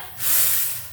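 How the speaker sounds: breathy whooshing exhale; audible exhale sigh. Shown as a demonstration.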